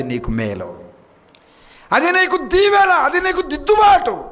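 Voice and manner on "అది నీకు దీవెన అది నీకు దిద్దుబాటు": much higher and louder; preacher's rising fervent cry; fervent exclamation. Acted. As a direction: cry it out to the congregation.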